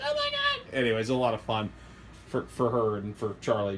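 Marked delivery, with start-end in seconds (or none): 0.0-0.7 imitating an excited squeal, extremely high; mock-squeak it softly, way up high; helium voice. Husband imitating his excited wife, helium voice. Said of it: "she's speaking like she just did a bunch of helium".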